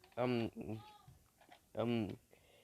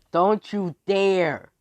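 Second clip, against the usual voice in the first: slow, loud, high-pitched warning; outraged warning; 6 semitones higher, 8 dB louder, much slower than usual. Acted. All the others warning him off, outraged shout. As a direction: stretch every word into a loud threat.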